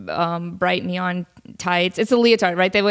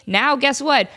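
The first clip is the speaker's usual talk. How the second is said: ordinary talk, nothing marked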